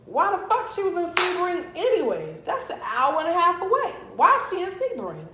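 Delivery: high, disbelieving relative demanding answers; imagined relative, outraged. Pretend. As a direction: voice a shocked family member who can't believe where she was. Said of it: "When the fucking coroner calls my mama".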